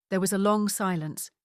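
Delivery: plain reading voice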